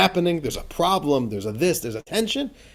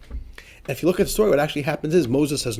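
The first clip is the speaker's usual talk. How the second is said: ordinary delivery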